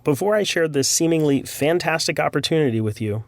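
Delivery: ordinary talk, nothing marked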